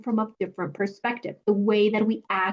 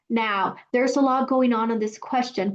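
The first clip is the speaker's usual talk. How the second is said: normal talking voice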